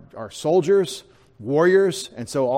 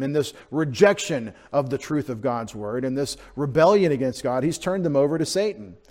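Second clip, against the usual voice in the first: usual speaking voice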